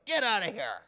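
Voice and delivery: loud, high mock yell; acted outraged vendor's shout; angry dismissal. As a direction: shout them out of the shop. Vendors throwing haggler out, acted.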